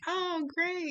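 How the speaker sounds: high bubbly "Oh, great"; super happy. Retold or imitated. High, eager reply to his hello on the phone. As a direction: gush it, faking delight. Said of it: "super happy".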